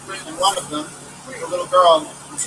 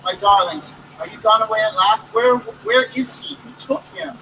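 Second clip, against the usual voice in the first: higher, louder frantic questions; crying in distraction; 6 semitones higher, 4 dB louder than usual. Acted. Mother questioning the children, mildly distraught. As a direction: fire questions in panic. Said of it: "she cried in distraction".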